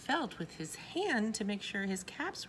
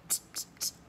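monkeys' mocking tsks. A narrator's reading. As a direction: separate voiceless tongue clicks. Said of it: "shook their fingers back at him and said".

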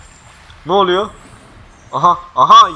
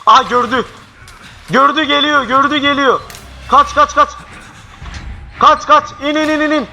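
panicked yelling; 8 semitones higher than usual. Real, not acted. Chased by an enemy, yelling to flee in panic. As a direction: yell in real panic as it chases you.

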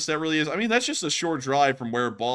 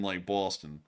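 low, soft touch of Boston accent; 6 semitones lower, 7 dB quieter, slower than usual. Real, not acted. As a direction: slip lightly into a Boston accent. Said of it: "said it like I was Boston".

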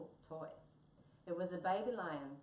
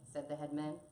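usual speaking voice

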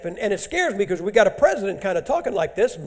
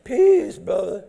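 mock peaceful greeting; slower than usual. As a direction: say it like a naive pacifist being sarcastically imitated. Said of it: "until they go".

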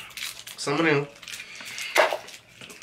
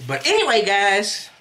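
higher, mocking host imitation; mimicking host voice; 4 semitones higher, slower than usual. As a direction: parrot the host's sign-off. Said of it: "How you just go from wig into".

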